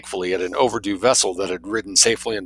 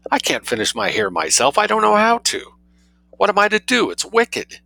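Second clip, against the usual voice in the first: high, tragic society lady; high-pitched lament over her hair; 9 semitones higher than usual. A narrator's reading. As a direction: wail it like a pampered mistress in despair. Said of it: "exclaimed Sophie tragically".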